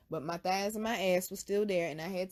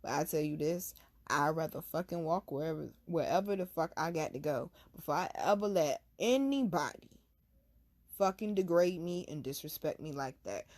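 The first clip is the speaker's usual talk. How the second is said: ordinary delivery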